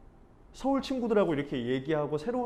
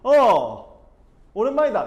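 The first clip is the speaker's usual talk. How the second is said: booming, higher Busan hello on the phone; loud Busan greeting; 6 semitones higher, 12 dB louder, slower than usual. Retold or imitated. Old Busan friend calls, answered with a loud Busan hello. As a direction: blurt out a hearty Busan greeting. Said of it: "그 친구들이랑 통화하면 이렇게 막".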